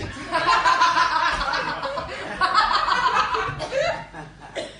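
long high-pitched cackle; a long burst of high laughter, falling away; big high laugh. Real, not acted. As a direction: crack up at your own line. Comic cracks up at his joke.

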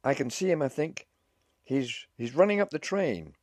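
high, loud running report; excited shouting. A narrator's reading. Officer at the carriage door spotting the husband, shouting. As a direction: call it back urgently, stumbling on the start.

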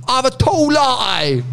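high, drawn-out British order; exaggerated British accent. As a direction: put in the coffee order in broad British, high and slow. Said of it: "then you tried to speak American to her".

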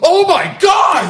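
huge high, drawn-out shout; mock fan freak-out. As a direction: stretch it into an over-the-top gasp of amazement. Retold fan reaction to credits scene.

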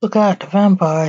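usual speaking voice

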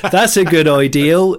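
emphatic Bristol impression; Bristol accent. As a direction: imitate a Bristolian, L on the end of "ideal". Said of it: "good ideal instead of good idea".

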